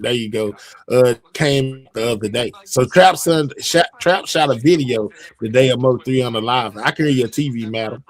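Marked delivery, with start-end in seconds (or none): none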